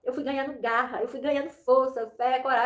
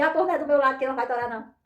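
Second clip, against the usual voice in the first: quick words full of laughter; laughing defiance; faster than usual. Retold or imitated. Woman laughing back at her uncle's warning.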